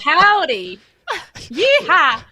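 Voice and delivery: loud, high cowboy Howdy and Yeehaw; hammed-up American cowboy holler; over-the-top Texan drawl on request; American accent. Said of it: "could you please do an American accent?".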